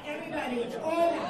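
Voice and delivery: normal talking voice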